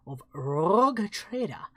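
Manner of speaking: Rogue Trader with a trilled, rolled R. Shown as a demonstration. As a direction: roll the R in Rogue Trader slowly. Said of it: "as I roll my R's".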